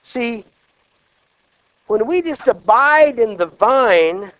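loud, slow scripture proclamation; forceful preaching of the verse; preaching at full force. Acted.

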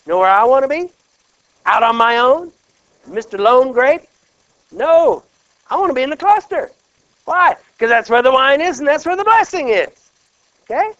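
loud, high preaching climax; loud rising sermon voice; preaching at full force. Acted. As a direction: build it up and lift the room. Preacher building to a peak.

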